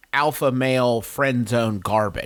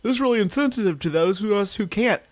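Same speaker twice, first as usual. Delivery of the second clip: whiny caller; 6 semitones higher than usual. Retold or imitated. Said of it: "on the phone like".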